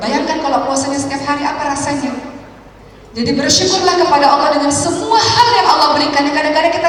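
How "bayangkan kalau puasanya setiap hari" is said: ordinary talk, nothing marked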